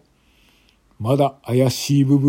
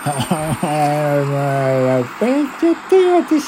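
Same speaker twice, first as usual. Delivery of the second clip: put-on dead drunk woman; 3 semitones higher than usual. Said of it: "一人の泥酔した女性が".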